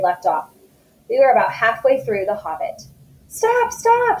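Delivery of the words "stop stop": high shouted stop as Thorin; dwarf leader yelling at his men to stop, shouted. Acted. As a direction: cry out to the dwarves to stop. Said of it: "shouted Thorin".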